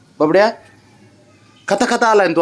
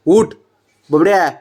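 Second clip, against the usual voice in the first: ordinary delivery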